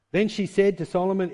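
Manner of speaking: normal talking voice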